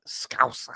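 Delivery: "Scouse" with a spitty, throaty rasp; hammed-up phlegmy "Scouse". Shown as a demonstration. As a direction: gargle spit through "Scouse". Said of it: "they spit".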